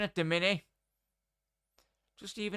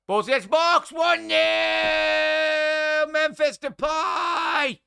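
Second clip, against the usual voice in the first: much higher, much louder roaring shout, slowing on the name; goal celebration; 13 semitones higher, 10 dB louder, much slower than usual. Real, not acted. Streamer roaring the goal to chat, ecstatic shout. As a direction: roar the goal and stretch out the scorer's name. Goal scored, live commentary.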